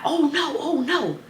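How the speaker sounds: high, alarmed mom voice; mama protesting. Retold or imitated. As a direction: play the panicked mother. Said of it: "so why the mama, like".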